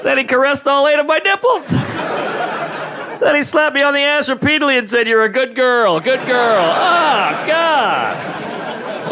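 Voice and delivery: squeaky outraged chihuahua on the stand, cracking up; chihuahua testifying in court. Pretend. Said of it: "Just some chihuahua in a courtroom".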